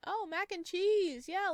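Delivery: light up at the sight of it; delighted discovery. Real, not acted. Player spotting mac and cheese, delighted slow coo. Spotting favourite food in store.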